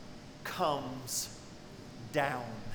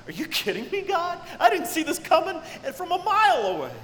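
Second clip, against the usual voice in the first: much higher, louder, faster disbelieving exclamation; astonished outcry; 9 semitones higher, 4 dB louder, faster than usual. Retold or imitated. Believer crying out to God in astonished disbelief. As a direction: shout it like the blessing blindsided you.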